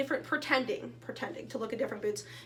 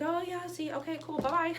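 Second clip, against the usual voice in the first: awkward brush-off; 6 semitones higher than usual. Retold or imitated. Brushing off the ladies.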